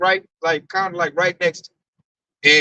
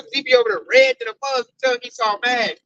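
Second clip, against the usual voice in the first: ordinary talk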